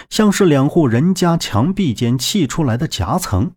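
plain reading voice

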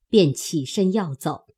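ordinary delivery, nothing marked

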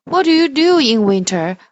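loud, lively read-aloud line; reading to children. Shown as a demonstration. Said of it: "我们再把这个故事读一遍".

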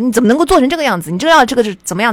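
loud, fast, fierce telling-off; mimicking a harsh boss chewing out an employee; stern boss scolding. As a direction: rattle it off like an angry boss dressing down a junior. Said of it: "就很凶的跟我讲说".

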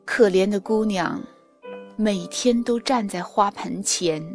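usual speaking voice

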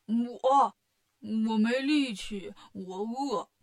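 pouting, drawn-out whine of refusal; whiny, slow-spoken sulk; sulky pouting Bajie. Acted. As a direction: play a sulking pig demon dragging out his excuse. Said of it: "八戒一撅嘴".

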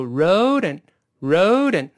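road末尾的d连到and，读成一个词. Shown as a demonstration. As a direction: road and连着读，and弱化成啊加前鼻音. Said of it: "最后那个的再跟后面的连词连读". (把road和and连起来读)

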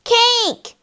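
high-pitched shout of greedy twins; excited children's shout. A narrator's reading. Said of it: "shouted the twins".

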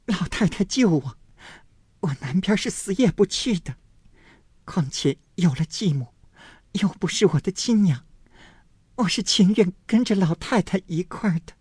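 put-on high, pleading young girl's voice. A narrator's reading. Daiyu begs her grandmother, high pleading girl's voice. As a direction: beg the old lady to keep her. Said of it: "抱着贾母的腰说道".

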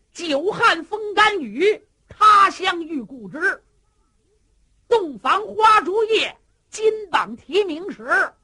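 loud, slow, high proverb recitation; slow booming recitation; declaiming verse. Acted. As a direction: proclaim the old saying with gusto. Cheng Yaojin declaims a proverb verse.

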